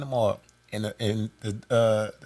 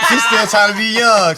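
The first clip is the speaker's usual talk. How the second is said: laughing tease; 3 semitones higher, 8 dB louder than usual. Real, not acted. Laughing teasing about mama.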